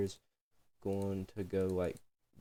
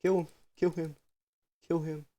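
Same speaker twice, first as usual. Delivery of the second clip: higher, louder repeated call; mock egging-on; 9 semitones higher, 6 dB louder than usual. Friend butting in on the tattoo talk, mock egging-on. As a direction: call it out high and loud, in jest. Jokingly urging on a killing.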